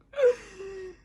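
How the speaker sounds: high, loud, whimpering gasp; overwhelmed squeal. Real, not acted. Fan holding the pulled card, tearful squealing gasp. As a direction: gasp into a cry.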